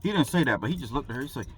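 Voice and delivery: ordinary delivery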